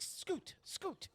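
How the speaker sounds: high, hushed shooing; shooing older woman. Acted. Madam clearing girls from parlor, high shooing. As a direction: shoo the girls away in a high voice. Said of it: "She's like".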